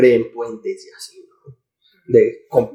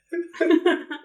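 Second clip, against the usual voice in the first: high laugh; 7 dB quieter than usual. Real, not acted.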